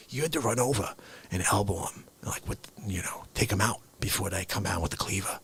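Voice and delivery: soft, fast whispered rescue; comic whisper. Pretend. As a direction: whisper it urgently so nobody hears.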